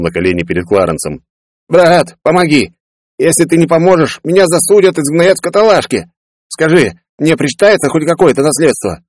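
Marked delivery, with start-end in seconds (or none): 1.7-9.0 begging brother. Acted. Kneeling brother begging Clarence for help, higher pleading voice. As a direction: plead desperately with your brother. Peller begs on knees.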